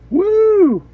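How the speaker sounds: high, loud gleeful woo; a real, joyful cheer as the wipers go; excited whoop. Real, not acted. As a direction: let out a high, delighted whoop. Showing off windshield wipers.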